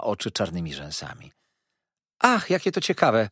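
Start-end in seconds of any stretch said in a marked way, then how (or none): 2.2-3.3 high, loud mock delight; exclaiming. Miss Dartle gushing at the boys' talk, mock delighted. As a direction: gush with feigned interest. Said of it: "zawołała".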